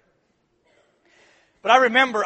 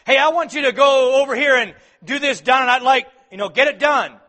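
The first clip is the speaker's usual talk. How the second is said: loud, high bossy order; demanding parent; 8 semitones higher, 11 dB louder than usual. Retold or imitated. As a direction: order it done now. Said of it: "you ask them to carry out a task".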